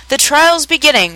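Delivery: high-pitched announcing call; storybook herald's cry; sudden cry. A narrator's reading. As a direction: cry it out to all, pitched well up. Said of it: "Suddenly the cry".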